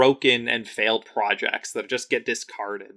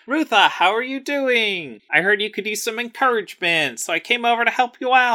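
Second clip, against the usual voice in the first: higher, lightly voiced sugary flirt; mock-sweet offer of help, pitched up; sweet encouraging Felisa; 11 semitones higher, 3 dB louder than usual. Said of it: "And Felisa's like".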